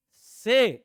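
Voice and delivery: teacher's emphatic से; punched से. Shown as a demonstration. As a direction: hit से hard and high like a teacher driving it home. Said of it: "तो भी से change हुआ क्या?".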